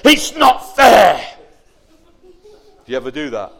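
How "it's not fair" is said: put-on sulky child. Child sulking after slamming door.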